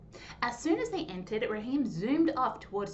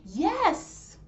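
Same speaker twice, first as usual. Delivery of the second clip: loud high yes, bursting with joy; excited scream; 8 semitones higher, 7 dB louder than usual. Acted. Boy screaming yes to the coach, overjoyed. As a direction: shout it out like he just made the team. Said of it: "Raheem screamed".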